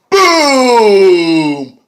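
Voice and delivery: long, high, loud falling boom; shouted sound effect, stretched out; booming exclamation. Retold or imitated. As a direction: draw it out like a big explosion. Mimics a big dramatic moment.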